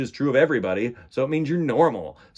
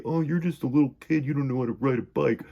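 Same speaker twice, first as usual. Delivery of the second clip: mock teasing; faster than usual. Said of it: "make fun of me a little bit and say".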